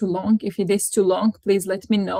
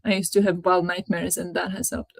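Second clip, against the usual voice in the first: ordinary delivery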